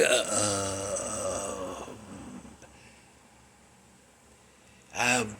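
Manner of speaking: low, soft, dragged-out stammer; hushed mock fumbling with long gaps; acted speechlessness. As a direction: act lost for words, barely getting sounds out. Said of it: "I was just like".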